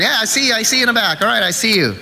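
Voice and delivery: lightly voiced altar-call preacher. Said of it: "the preacher was".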